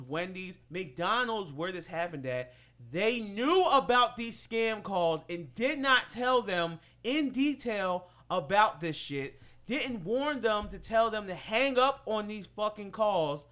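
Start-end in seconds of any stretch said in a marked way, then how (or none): 2.9-13.5 indignant rant. Real, not acted. Outraged friend ranting about the scam calls, loud and indignant. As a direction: vent about the restaurant's cover-up.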